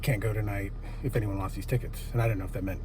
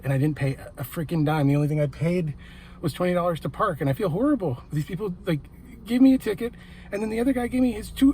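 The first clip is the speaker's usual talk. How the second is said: climbing ever higher with giddy disbelief; can't believe her luck, voice soaring; breathless, gushing fan after the show; real excitement; 7 semitones higher than usual. Real, not acted. Fan gushing over free ticket.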